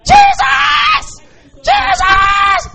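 desperate high shout of the name; desperate shouted cry. Retold or imitated. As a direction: cry out Jesus like a blind beggar. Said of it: "the more he shouted".

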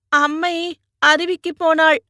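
put-on high-pitched small child's voice; high little voice of a girl who was just crying; little girl after crying. A narrator's reading. Tearful niece answers her uncle about mother, high child voice. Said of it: "ரங்கி அழுகையை நிறுத்திவிட்டு".

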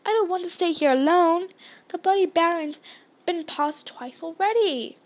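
a touch of panic; frightened pleading. Acted. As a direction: plead like a mildly scared child. Neville pleads not to be left.

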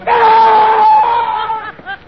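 long, high, loud scream; terrified scream. Acted. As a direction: scream out in sheer horror, letting it tail off. Terror at the apparition.

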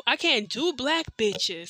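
slow, drawn-out uncle's line; slow uncle impression. Narrator imitates the uncle's slow boast. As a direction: drag it out, lightly put-on. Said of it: "he'll be like".

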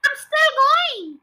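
high, loud, drawn-out screech; screechy old voice. A narrator's reading. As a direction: screech it down from above. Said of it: "old screechy voice from up above".

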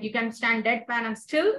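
usual speaking voice

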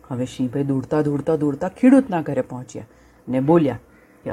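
ordinary delivery

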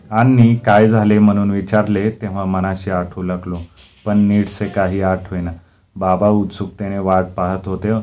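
plain reading voice

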